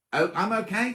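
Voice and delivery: ordinary talk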